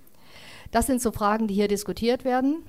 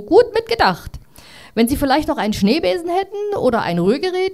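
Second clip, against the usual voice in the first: high, loud, chummy kangaroo; cheeky kangaroo; 6 semitones higher, 4 dB louder than usual. Acted. Kangaroo praises the narrator and asks for a whisk, high and loud. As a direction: praise him, then push for more.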